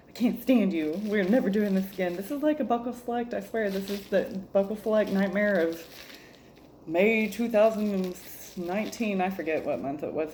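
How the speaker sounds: normal talking voice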